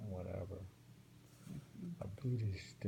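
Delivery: normal talking voice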